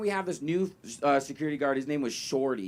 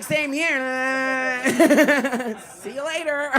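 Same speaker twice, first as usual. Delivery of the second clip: mock HR guy; 11 semitones higher, 9 dB louder, much slower than usual. HR quoted as horny guy.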